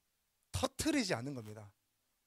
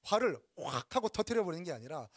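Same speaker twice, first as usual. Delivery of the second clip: normal talking voice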